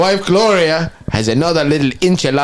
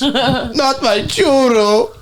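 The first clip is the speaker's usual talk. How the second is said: mock lament; 10 semitones higher, much slower than usual. Voicing the sedated friend.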